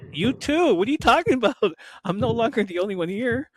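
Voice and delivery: loud, high-pitched playful retort; gleeful comeback. Retold or imitated. Speaker to uncle who shares the teasing, gleeful retort. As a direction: fire back in played-up indignant glee.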